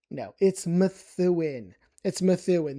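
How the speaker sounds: Methuen as meh-THOO-in, slow and careful; patient local Methuen; correct Methuen. Shown as a demonstration. Correcting his childhood self, slow Methuen. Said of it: "I would call it Methuen".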